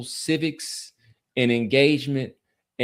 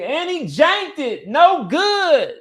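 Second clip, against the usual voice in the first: excited call; 6 dB louder, slower than usual. Real, not acted. Live missed field goal reaction.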